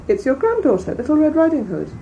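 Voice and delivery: high, lightly voiced young girl; girl answering her grandmother's door; little girl's voice. A narrator's reading. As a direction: answer brightly like a small child.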